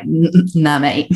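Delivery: a doubting shoulder gremlin scoffing. Retold or imitated. The gremlin on her shoulder scoffing at her, sneering. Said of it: "the gremlin on the other side who's like".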